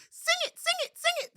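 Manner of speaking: very high, loud, squealing monkey cry; thrilled little monkey spotting a visitor; excited monkey. A narrator's reading. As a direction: shriek with delight like a little monkey. Monkeys begging for song.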